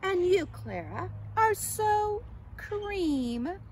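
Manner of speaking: high, loud, drawn-out put-down; conceited butterfly sneer; snooty disdain. A narrator's reading. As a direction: stretch the last words with haughty scorn. Conceited Katisha belittles Clara.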